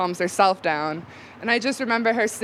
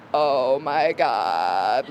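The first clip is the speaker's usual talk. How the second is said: slow, much lower, drawn-out stunned gasp of words; 8 semitones lower, much slower than usual. Retold or imitated. Teen on acid hearing from dad that the dog died, stunned and slow. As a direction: let the shock hollow the words out.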